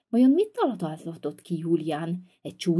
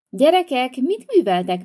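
loud call; 5 semitones higher, 5 dB louder than usual. Acted. Mother bursts in on quarrel.

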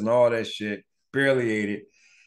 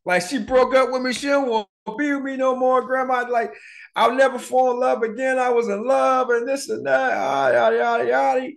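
mock bawling; 12 semitones higher than usual. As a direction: whine it like a heartbroken kid. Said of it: "start bawling".

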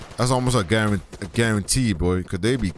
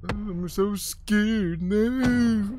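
high, slow whimpering mockery of a camper; high mock-frightened whimper; mock scared kid; 12 semitones higher, much slower than usual. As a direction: drag it out like a terrified kid. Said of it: "that's how you kids sound".

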